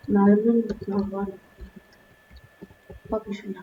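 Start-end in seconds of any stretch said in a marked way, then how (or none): none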